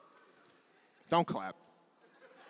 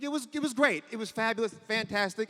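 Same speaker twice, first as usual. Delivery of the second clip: overselling it; 5 semitones higher, 3 dB quieter than usual. Retold or imitated.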